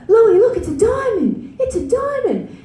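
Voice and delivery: put-on high, slow, pleading little girl; a kid begging her little brother to look; high pleading child voice.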